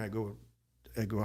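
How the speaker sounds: usual speaking voice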